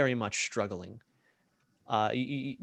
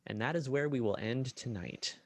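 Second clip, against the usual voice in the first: usual speaking voice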